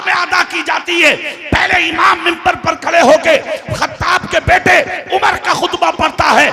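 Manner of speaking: high, loud, sustained sermon shout; impassioned preaching. Real, not acted. Preacher arguing from the Friday sermon, at full force. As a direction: hammer it out to the congregation.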